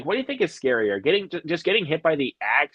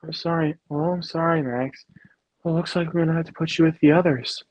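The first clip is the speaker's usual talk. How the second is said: Grabber impression; 4 semitones higher than usual. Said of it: "He's like".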